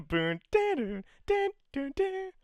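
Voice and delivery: loud, high-pitched mock ringtone; sung ringtone imitation. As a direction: sing out a call jingle through a laugh. Imitates Skype call ringtone.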